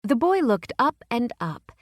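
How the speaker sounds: usual speaking voice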